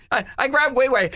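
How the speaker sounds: ordinary talk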